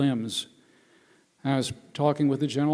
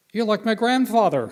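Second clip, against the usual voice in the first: higher, louder quoted punchline; mock blurted remark; 7 semitones higher, 5 dB louder than usual. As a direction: deliver the soldiers' line as a punchline. Acted soldiers' punchline quote.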